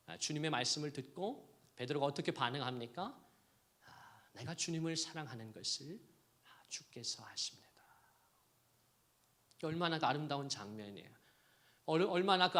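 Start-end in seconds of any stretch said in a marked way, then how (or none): 3.9-7.7 soft, hushed confession; humble near-whisper. Acted. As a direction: murmur it in shame. Peter answers humbly. (부끄러워하는 베드로처럼 속삭이듯)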